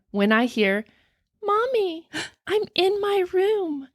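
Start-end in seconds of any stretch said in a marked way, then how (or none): 1.4-3.9 put-on high, groggy little-girl voice; a drowsy daughter calling Mommy; groggy child's voice. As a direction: sound like a sleepy child calling. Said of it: "It's my daughter's voice, her groggy, tired voice".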